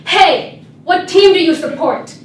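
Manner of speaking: put-on loud, challenging kid's shout; playground kid. Kid on the playground challenging her, loud. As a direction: demand it like a bully.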